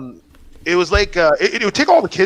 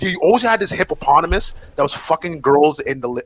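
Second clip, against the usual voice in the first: ordinary talk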